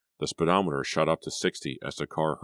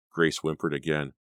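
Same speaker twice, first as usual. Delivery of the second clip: usual speaking voice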